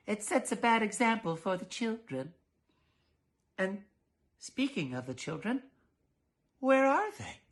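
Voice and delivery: put-on a prim mother suddenly missing her kids. Mrs Dollard voiced.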